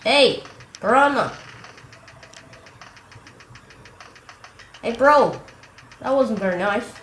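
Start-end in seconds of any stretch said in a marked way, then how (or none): 0.0-5.4 high, loud alarmed shouts; startled yells. Real, not acted. Gamer attacked by a piranha plant, yelling high and loud. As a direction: yell as the plant snaps at you.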